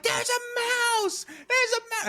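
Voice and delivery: hammed-up alarm, voice jumped way up and slowed; mock panicked shriek. Wife spotting a mouse on the couch, mock panicked high squeal. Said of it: "Yvonne's like".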